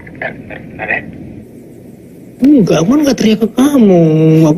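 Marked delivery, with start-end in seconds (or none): 0.0-1.0 hushed, voiceless aside; whisper. Real, not acted. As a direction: whisper so the caller can't hear. Hushes while on video call.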